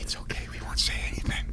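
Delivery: very soft, breathy promise of secrecy; hushed mock-confidential aside; conspiratorial whisper. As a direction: imitate a knowing fan whispering reassurance. Fans promising to keep his secret.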